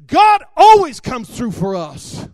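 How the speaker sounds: loud, slow, and firm, every word pressed home; pointing at him and declaring it with total conviction; emphatic declaration. Retold or imitated. Wife points finger, declares.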